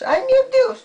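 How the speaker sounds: high, loud mock dismay for the child. Shown as a demonstration. Grandma showing the child how to exclaim, high and loud. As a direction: cry it out high like a game.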